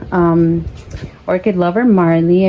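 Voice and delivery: normal talking voice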